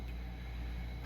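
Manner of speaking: long weary exhale through a stuffy nose; heavy sigh. Real, not acted. Fed up with allergies, a long tired sigh.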